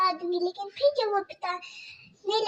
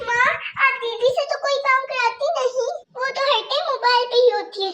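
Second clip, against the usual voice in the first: put-on much higher, small pleading girl voice; pleading young girl; 5 semitones higher, 4 dB louder, faster than usual. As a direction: Kajal begging her stepmother about stomach pain.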